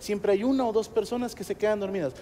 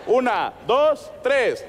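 slow, raised count, each number held; slow, higher countdown; slow dramatic count; 4 semitones higher, much slower than usual. Shown as a demonstration. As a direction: count the crowd in slowly. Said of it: "vamos a gritar".

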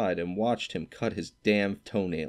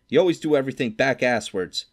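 higher, louder furious scolding; 6 semitones higher, 6 dB louder than usual. A narrator's reading. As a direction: scold him in real fury. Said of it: "He was really furious".

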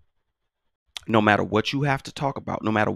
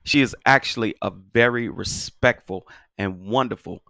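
normal talking voice

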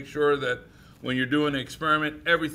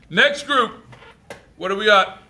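loud, raised-pitch summons; calling out; 6 semitones higher, 8 dB louder than usual. Real, not acted. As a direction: call the next group up loudly. Calling up the next group.

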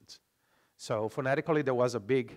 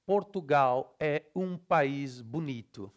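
slow Brazilian reading, syllable by syllable; Brazilian Portuguese accent; slower than usual. Shown as a demonstration. Teacher contrasting with Portugal, slow open Brazilian Portuguese. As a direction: spell out every vowel in a Brazilian accent. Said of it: "Whereas in Brazilian Portuguese".

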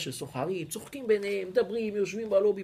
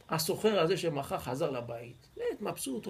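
ordinary delivery

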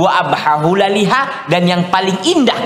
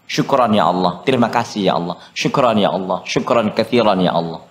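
grateful murmured prayer; 8 semitones lower than usual. Shown as a demonstration. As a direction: model a humble, unhurried thank-you to God. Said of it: "Biasakan lisan antum".

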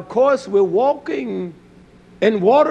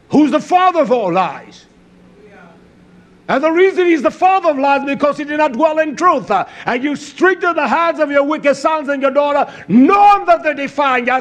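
raised, forceful scolding from the pulpit; fiery preaching; 8 semitones higher, 5 dB louder than usual. Real, not acted. Preacher rebuking parents who excuse their children, fiery preaching. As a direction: preach it hard, driving each rebuke home.

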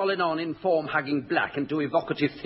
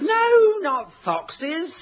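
high camp no; 10 semitones higher, much slower than usual. Acted. Camp reply on foxes.

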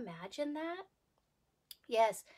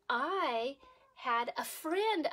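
ordinary delivery, nothing marked

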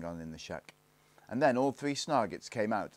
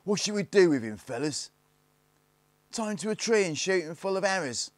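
high, loud, eager threats; gang boys plotting; 11 semitones higher, 8 dB louder than usual. Acted. Gang debates the prisoner's fate, high loud voices.

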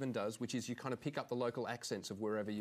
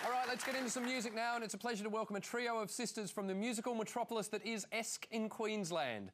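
high, lifted announcer voice, slowing on the name; show host introduction; 7 semitones higher than usual. Real, not acted. Host welcoming a trio of sisters, high announcer voice. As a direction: bring on the act, stretching out the final name.

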